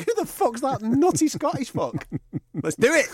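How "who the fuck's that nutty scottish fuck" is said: rivals' reaction. Retold or imitated. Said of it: "They'll think".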